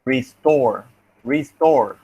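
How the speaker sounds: "restore" with the weight on STORE; second-syllable stress. Shown as a demonstration. Teacher tests where the stress mark is, second-syllable stress. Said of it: "It's in the second syllable".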